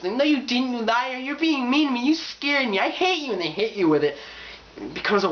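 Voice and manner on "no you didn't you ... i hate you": high, whiny tantrum; mock angry fangirl. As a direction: accuse in a high, rushed mock fury. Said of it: "then they get mad at you".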